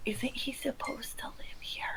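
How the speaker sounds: very soft whispered question. Acted. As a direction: whisper so the knight won't hear. In character, hiding from the knight.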